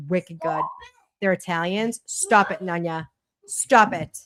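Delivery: normal talking voice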